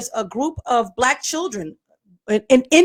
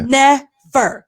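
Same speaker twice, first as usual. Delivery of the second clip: "Never" drawn out in two beats; slow, hard stress on "Never"; emphatic; 3 semitones higher, 4 dB louder than usual. Real, not acted. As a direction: split "Never" into two heavy syllables. Repeating for emphasis.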